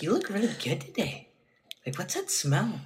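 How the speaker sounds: high-pitched mock fawning; gushing imitation. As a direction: imitate a smitten partner cooing compliments. Mimics partner gushing over them.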